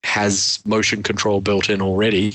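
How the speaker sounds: usual speaking voice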